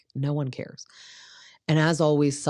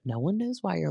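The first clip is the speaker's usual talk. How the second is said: ordinary talk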